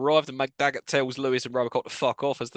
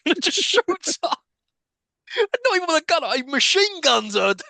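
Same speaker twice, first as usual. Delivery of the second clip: high-pitched, stretched-out disbelief; incredulous outrage; 12 semitones higher than usual. Real, not acted. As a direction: recount it as utterly over the top, voice way up. Incredulous retelling of a killing.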